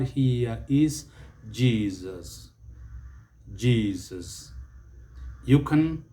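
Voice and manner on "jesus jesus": Jesus with a voiced z in the middle; Z pronunciation. Shown as a demonstration. Teacher models the word for learners, Z-sound pronunciation. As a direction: say Jesus as JEE-zus, falling. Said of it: "it takes the Z sound".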